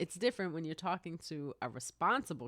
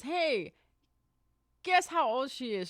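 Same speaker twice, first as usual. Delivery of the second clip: high, louder gleeful announcement; retold calling out; 8 semitones higher, 4 dB louder than usual. Nurse telling a colleague about the patient, calling out. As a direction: call it over to a colleague, pitched up.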